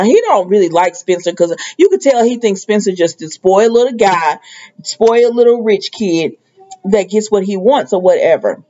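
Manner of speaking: ordinary delivery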